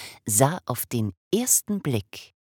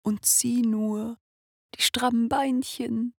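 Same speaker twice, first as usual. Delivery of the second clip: high, slow, tender whisper; doting whisper; 12 semitones higher, slower than usual. Acted. Father admiring his newborn son, tenderly whispering. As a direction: coo over the baby's legs.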